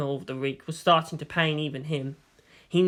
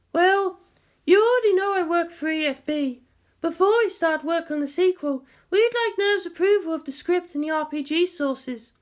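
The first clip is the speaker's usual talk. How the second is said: higher female voice, businesslike; young woman; 12 semitones higher than usual. A narrator's reading. Kodama introduces herself to Makoto, higher female voice.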